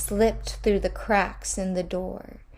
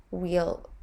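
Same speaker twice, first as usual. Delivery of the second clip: plain reading voice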